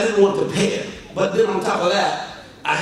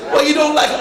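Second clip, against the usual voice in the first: surprised host; 8 semitones higher, 6 dB louder, faster than usual. Retold or imitated. Host checks pastor's reaction.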